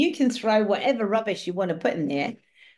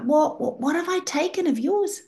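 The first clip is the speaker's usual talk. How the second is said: much higher, pleading wail, lightly done; mock crying plea; 9 semitones higher than usual. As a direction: imitate a tearful plea without real sobs. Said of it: "by this time he's crying".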